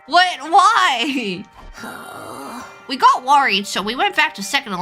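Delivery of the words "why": ordinary delivery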